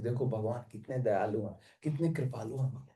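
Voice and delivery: hushed inner thought. Retold or imitated. Devotee moved, thinking to himself.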